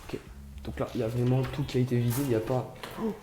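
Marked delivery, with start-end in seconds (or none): none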